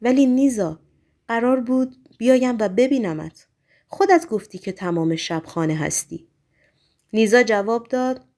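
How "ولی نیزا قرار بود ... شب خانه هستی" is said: usual speaking voice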